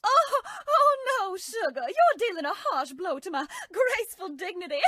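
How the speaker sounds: high pleading through giggles; laughing protest. Acted. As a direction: squeal in mock outrage while laughing. Tickled again.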